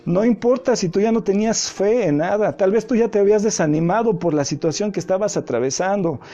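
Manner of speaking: preaching. Acted. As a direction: build up with pleading intensity. Climactic preaching to the listener.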